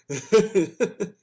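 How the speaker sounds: high burst of laughter. Real, not acted. Laughing about the kids' faces, high burst. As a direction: crack up.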